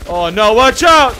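loud, drawn-out warning shout; panicked warning. Pretend. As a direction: yell it as danger closes in.

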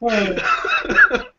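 long, high-pitched burst of laughter; hearty laugh. Real, not acted. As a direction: crack up at the shared joke. Joking about the donkey's name. (ضحكة عالية من القلب)

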